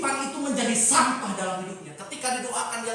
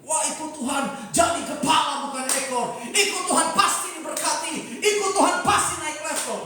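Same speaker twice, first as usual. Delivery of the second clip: mock prosperity hype, louder and higher as it builds; hyped prosperity preaching; 4 semitones higher, 3 dB louder than usual. Preacher parodies churches promising promotion and blessing, mounting mock hype. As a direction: parody an over-eager promise of success.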